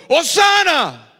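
slow, loud, high cry of hosana; crowd shouting. Retold or imitated. Preacher contrasting the shout with the bare word, loud cry. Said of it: "habían gritado".

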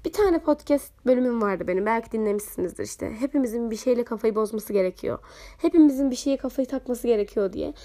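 normal talking voice

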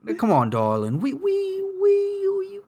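high, posh-sounding put-on British voice; a shaky attempt at a posh Tom Hardy; Tom Hardy impression. Game player imitating Tom Hardy, squeaking into a posh voice. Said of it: "why are you trying to do Tom Hardy?".

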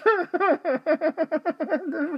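squealing laugh behind a mask; high giggle. Real, not acted. Laughs at own joke.

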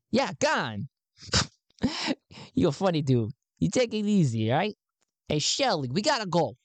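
an excited recruiter greeting a new believer; high-pitched Garn. Acted. Garn welcomes Sven into the cause, pitched high.

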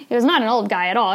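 ordinary talk, nothing marked

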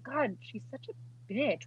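slow, hushed mutter of a harsh thought; muttered quote. Retold or imitated. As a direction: mutter it slowly under your breath. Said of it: "I was like".

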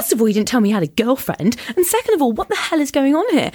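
high-pitched retold outrage; devastated, indignant. Telling off the date over a surprise girlfriend, indignant. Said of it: "I was devastated".

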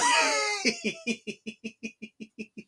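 helpless laughter trailing off; long laughing fit. Real, not acted. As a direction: crack up at your own impression. Cracks up at own impression.

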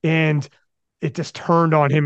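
ordinary delivery

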